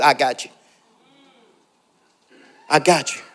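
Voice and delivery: quietly reassure, as God speaking; God reassuring. Retold or imitated. God's reassuring voice, retold.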